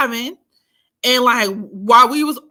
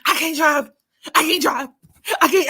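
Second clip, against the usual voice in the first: mock sobbing, higher; crying friend; 6 semitones higher than usual. Friend refusing to drive, mock sobbing. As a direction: whimper like the panicking friend.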